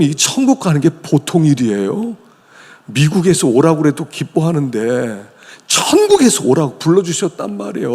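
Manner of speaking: usual speaking voice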